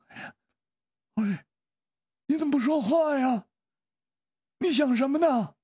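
high, anxious questioning; uneasy. A narrator's reading. Zhao prods silent Yue Yang, uneasy high voice. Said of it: "不安地说".

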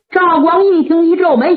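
ordinary delivery, nothing marked

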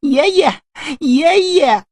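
put-on a little boy's high calling voice; child calling out. A narrator's reading. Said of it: "孩子还在那儿喊呢". (孩子高声喊爷爷)

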